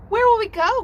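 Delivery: high, loud excited question; cygnets crying out. A narrator's reading. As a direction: cry it out like eager little swans. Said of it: "cried all the Cygnets".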